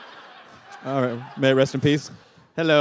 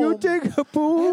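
high, drawn-out character voice; slow, high mock country call; Emily voice; 7 semitones higher than usual. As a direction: stretch it out, pitched up high. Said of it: "The Emily voice?".

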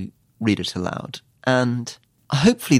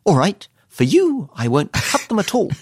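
Liz Truss; 9 semitones higher, 4 dB louder than usual. Retold or imitated. Minister giving in to a colleague, high hammed-up impression. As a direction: imitate a politician grandly giving in, with odd pauses. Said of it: "she puts pauses in".

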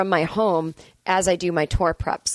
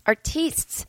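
higher, fancy French-ish artistes; mock French artistes; 8 semitones higher than usual. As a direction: sneer at pretentious artistes in a posh French way. Mocking pretentious artists.

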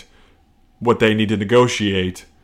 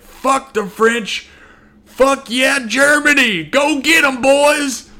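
very high, slow start to a mock battle cry; hammed-up rallying general; 4 dB louder, slower than usual. General urging his army into a last charge, hammed-up battle cry. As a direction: cheer the troops on like a gung-ho general. General rallies troops at war's end.

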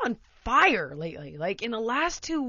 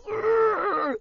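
much higher strained frustrated groan; 13 semitones higher, 6 dB louder than usual. Retold or imitated. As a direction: let out a fed-up groan.

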